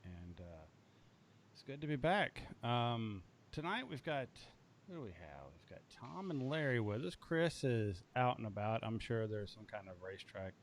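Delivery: very quiet, faint voice; barely audible. Real, not acted. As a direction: speak barely above a murmur. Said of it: "my voice is very quiet".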